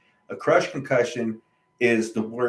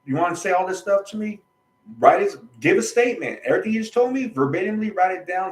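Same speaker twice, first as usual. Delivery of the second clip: higher and louder, demanding; retold confrontation; 7 semitones higher, 4 dB louder than usual. Telling the doctor to write a statement, higher and louder. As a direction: insist he put it in writing, raised voice. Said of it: "So I told him".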